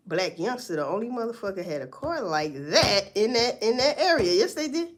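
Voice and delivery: ordinary talk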